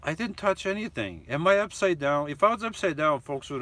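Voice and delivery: high, emphatic protest; insistent. Real, not acted. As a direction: argue back at the chat. Still insisting to chat.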